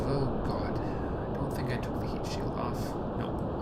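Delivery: hushed, dismayed whisper. Real, not acted. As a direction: whisper it in dread. Realises a mistake mid-flight.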